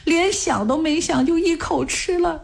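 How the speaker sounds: high, strained tearful voice; crying. A narrator's reading. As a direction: pitch climbing into a crying wail. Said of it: "哭着说". (边哭边说，声音高而发颤)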